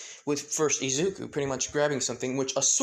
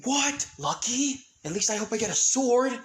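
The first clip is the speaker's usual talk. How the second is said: excited yelp; 9 semitones higher, 4 dB louder than usual. Acted. Ben excited about swords.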